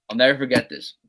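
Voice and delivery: ordinary delivery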